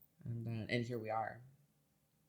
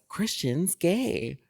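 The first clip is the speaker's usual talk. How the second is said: slow, drawn-out gossipy reveal, pitched up; dramatic announcement; 8 semitones higher, 10 dB louder, much slower than usual. Retold or imitated. Grandma outing grandson at Thanksgiving, slow dramatic reveal. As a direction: savour the reveal like juicy gossip. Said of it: "Like, very dramatic".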